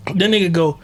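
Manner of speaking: ordinary delivery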